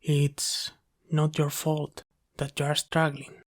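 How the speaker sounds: usual speaking voice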